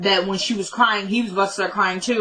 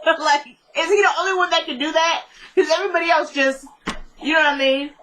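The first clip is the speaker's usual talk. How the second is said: laughing; 8 semitones higher than usual. Real, not acted. Laughing at the TV scene.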